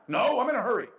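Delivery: high, loud, brushing God off; impatient retort. Pretend. Preacher acts himself refusing God's advice, impatient. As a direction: snap back like a driver in a rush.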